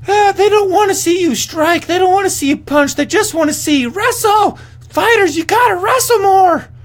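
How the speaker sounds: high, loud whiny mock voice; ridiculing a fighter's interview in a shrill mock voice. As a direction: parody her post-fight speech in a squeaky put-on voice. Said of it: "And she's like".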